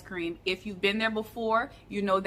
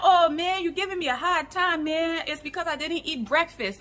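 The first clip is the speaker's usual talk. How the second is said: high, loud-starting imitation of a Nigerian man; Nigerian accent; 7 semitones higher, 4 dB louder than usual. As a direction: complain in a put-on Nigerian accent. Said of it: "do my best Nigerian accent".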